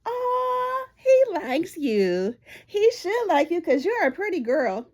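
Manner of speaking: high, slow sing-song tease; teasing customer. Retold or imitated. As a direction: tease her slowly and sweetly. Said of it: "they'll catch him staring".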